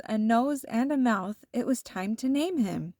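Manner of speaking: ordinary delivery, nothing marked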